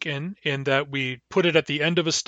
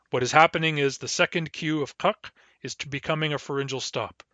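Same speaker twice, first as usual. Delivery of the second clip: normal talking voice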